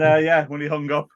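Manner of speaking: ordinary delivery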